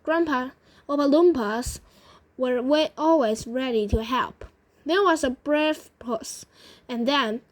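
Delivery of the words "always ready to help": ordinary delivery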